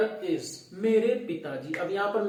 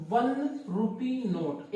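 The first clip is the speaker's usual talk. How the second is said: slow dictation; 4 semitones higher, much slower than usual. Shown as a demonstration. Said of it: "क्या चाहिए?".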